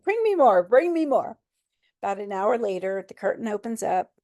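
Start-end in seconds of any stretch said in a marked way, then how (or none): 0.0-0.6 high, loud, eager demand. Retold or imitated. To the nurses, high loud eager demand. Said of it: "I'd be like". 0.6-1.4 eager demand. Retold or imitated. To the nurses, raised eager repeat. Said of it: "I'd be like".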